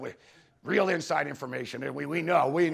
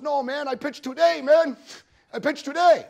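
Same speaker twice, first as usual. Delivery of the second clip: mock-giddy, higher and louder, laughing at the end; giddy boast; 8 semitones higher, 4 dB louder than usual. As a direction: toss it off like a drunk pitcher who thinks it's funny. Pitcher's laughing boast, retold.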